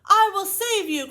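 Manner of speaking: high, loud heroic declaration; heroic cry. A narrator's reading. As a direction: promise rescue boldly. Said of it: "cried the prince".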